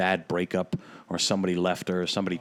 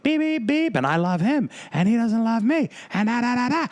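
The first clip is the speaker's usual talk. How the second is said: put-on drunk woman screaming; 14 semitones higher than usual. Imitating the drunk woman beeping and wailing again. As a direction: screech it like a heartbroken drunk. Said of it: "And she's screaming".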